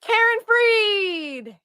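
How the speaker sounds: high, loud, drawn-out cheer; exultant rallying shout, slow and high; triumphant cry. Acted. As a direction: proclaim it like a victory chant, stretching it out. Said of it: "until we can say".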